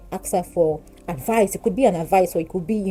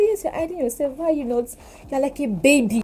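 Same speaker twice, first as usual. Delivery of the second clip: higher, lightly voiced scolding sister; 8 semitones higher than usual. Sister scolding her for hiding, mild higher mock scold. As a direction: tell her off like a big sister. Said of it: "She said".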